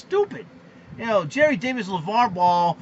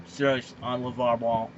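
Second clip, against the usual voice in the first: usual speaking voice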